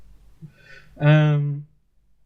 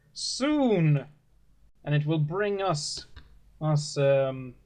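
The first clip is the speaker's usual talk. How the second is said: raised, lofty sermon voice of a gentle preacher; 4 semitones higher, slower than usual. Acted. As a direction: preach to the flock like a kindly father. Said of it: "spaceship model".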